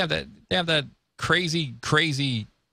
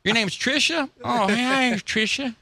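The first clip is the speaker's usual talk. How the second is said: flirty Bubba; 7 semitones higher than usual. Retold or imitated. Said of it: "he goes".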